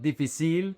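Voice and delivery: difícil with the stress shifted onto "cil", rising; mis-stress difícil on its last syllable; wrong final stress. Shown as a demonstration. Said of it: "It's not difícil".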